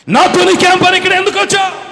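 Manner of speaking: loud, high demonic shout; full-force acted outburst, very high; Satan challenging Jesus. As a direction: yell it as a threatened devil. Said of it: "ఎందుకు మాట్లాడుతున్నాడు సైతాను".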